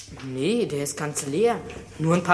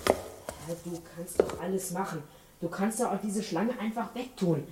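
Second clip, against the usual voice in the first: hushed pleading prayer; whispered prayer; 10 dB quieter than usual. Acted. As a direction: pray under your breath. Quiet prayer in the dark.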